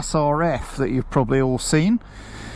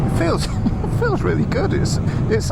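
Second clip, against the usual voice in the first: laughing; 7 semitones higher than usual. Real, not acted. Thrilled on first electric ride.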